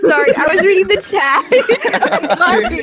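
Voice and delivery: high, giggly apology; laughing apology. Real, not acted. Apologizing to a friend for laughing over their story, laughing apology. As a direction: apologize through real giggles.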